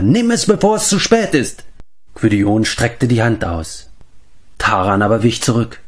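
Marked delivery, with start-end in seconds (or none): 0.0-1.6 high, pressing plea; urgent tempter. A narrator's reading. Tempter urging Taran to take it, urgent. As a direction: urge them to take it now.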